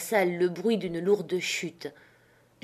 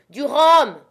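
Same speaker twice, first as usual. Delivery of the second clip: urgent shout; 8 semitones higher, 10 dB louder than usual. A narrator's reading. Wounded captain demands rum.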